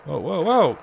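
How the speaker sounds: startled burst of whoa; surprised. Real, not acted. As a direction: jump at the sudden gunfire. Sudden gunfire surprises player.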